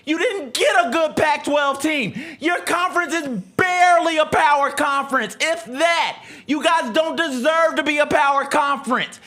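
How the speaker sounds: mock rant at the conference. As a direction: fire up and rant at the whole conference. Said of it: "Clip that shit".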